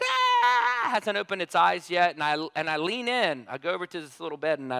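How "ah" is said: high loud imitated baby wail; imitating a newborn's cry. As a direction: wail like a just-born baby. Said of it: "the baby's like".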